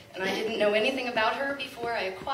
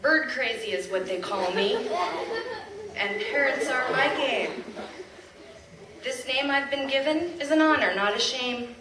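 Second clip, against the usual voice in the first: proud, louder poem reading with laughs; poem recital; 2 semitones higher, 6 dB louder than usual. Acted. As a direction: recite it to the crowd with pride. Reads a poem aloud.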